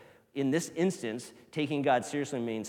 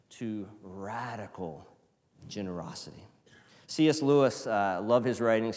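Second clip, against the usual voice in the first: usual speaking voice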